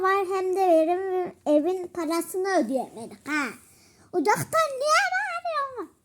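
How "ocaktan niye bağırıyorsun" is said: indignant. Pretend. Pretend sisters quarrel over shouting.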